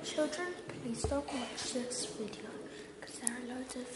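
whispering. Real, not acted. Warning kids in hushed voice.

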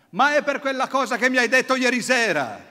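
high, loud, near-laughing shout. Retold or imitated. Father shouting at his son about last night's talk, loud and high. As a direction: burst out shouting, worked up. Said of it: "mio padre alza la voce e grida".

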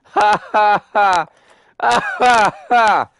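mock villain laugh. Gamer mocking his own blunder with a slow hammed-up cackle. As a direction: cackle at yourself like a cartoon baddie.

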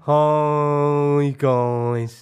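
long, loud drawn-out greeting; Producer Ben. Acted. Producer greets the guys, loud droning impersonation. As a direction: drone the greeting, stretching each word out. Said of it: "This one has two voices. Producer Ben".

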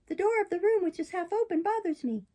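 high, complaining puppet voice; fussy puppet. Acted. Puppet stalling the fairy with excuses, fussy.

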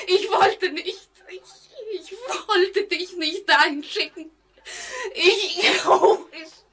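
wailing through tears; sobbing hysterically. Acted. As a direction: sob out the guilt.

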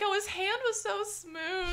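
high, dreamy gush; swooning fan. Retold or imitated. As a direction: swoon over his smooth hand. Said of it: "you were like".

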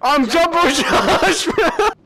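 high-pitched, laughing announcement; giddy character boast. Pretend. Player announcing himself as Jumbo Josh, giddy. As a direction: crow it excitedly through a laugh.

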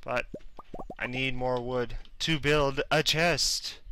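ordinary talk

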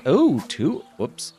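ordinary delivery

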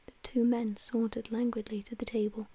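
ordinary delivery

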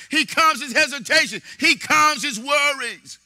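high, loud preaching with shouted peaks; fiery sermon climax; full-force preaching. Acted. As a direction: drive the faith message home to the congregation.

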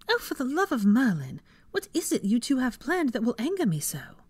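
high-pitched exasperated woman's voice. A narrator's reading. Hermione to two plotting wizards, exasperated. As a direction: demand an answer, pitch well up.

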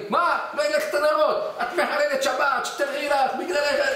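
hammed-up fury at the wife; mock angry shouting. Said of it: "לא, לא, בנחת".

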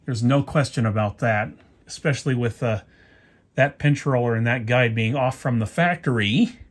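usual speaking voice

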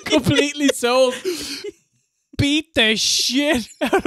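laughing. Real, not acted. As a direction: crack up while telling it.

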